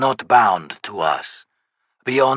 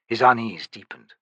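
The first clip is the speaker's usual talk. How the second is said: ordinary delivery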